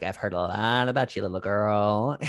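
low imitated officer addressing a child; military man. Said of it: "military man comes in and is like".

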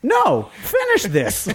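very high, drawn-out mock yell; retold command. Bystander ordering a quitter to keep fighting, indignant mock yell.

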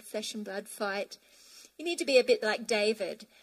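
ordinary delivery

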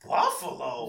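mock disbelief. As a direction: repeat the town as if you can't believe it. Others' disbelief at his answer.

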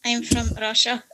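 attempted Russian accent; rusty Russian accent demo. Shown as a demonstration. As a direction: try a modest Russian accent. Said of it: "my Russian accents".

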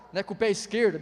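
usual speaking voice

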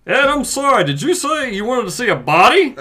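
a touch of a pompous dad; lightly voiced grieving father; stuffy father voice. Reads father role.

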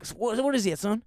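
mimicking a hard-of-hearing diner regular; old customer voice. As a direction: pitch up into a mock old-timer. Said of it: "he talks like a Republican".

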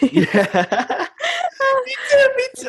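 high squealing laughter, words caught in it; squealing laugh of recognition. Real, not acted. Laughing at own overthinking.

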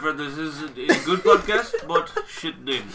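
put-on accent with a laugh in it; Arun impression. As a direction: do a hammed-up comic foreign accent of a friend. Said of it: "I just did the Arun impression".

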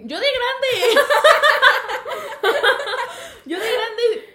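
high, loud blurted confession; gleeful outburst. Real, not acted. Friend confesses to her co-host, gleeful shout. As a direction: shout it out, bursting to laugh.

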